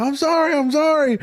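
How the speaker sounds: high, put-on pleading; mock pleading apology. Acting out his apology to passengers.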